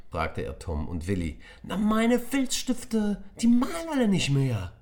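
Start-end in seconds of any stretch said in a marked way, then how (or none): 1.6-3.2 put-on high, slow, loud angry child voice; angry schoolboy complaint; Tom fuming. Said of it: "fauchte Tom". 3.4-4.7 put-on die malen alle nicht mehr in a high, loud angry child's voice; angry schoolboy version; Tom hissing angrily. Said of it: "fauchte Tom".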